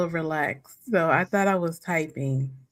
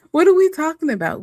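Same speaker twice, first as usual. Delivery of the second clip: excited; 11 semitones higher, 7 dB louder, faster than usual. Real, not acted. Laughing, asks topic excitedly.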